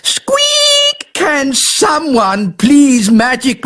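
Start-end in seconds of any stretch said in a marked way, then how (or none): 0.0-1.1 high squeaky mouse cry; mouse squeak. Acted. Elf turned into a mouse.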